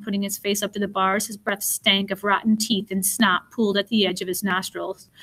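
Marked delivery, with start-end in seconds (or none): none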